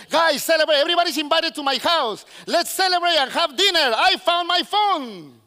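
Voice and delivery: high, loud mock jubilation; hammed-up party invitation to everyone; over-the-top announcing. Preacher mocks posting a found phone on Facebook, overexcited. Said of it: "you go all over Facebook saying".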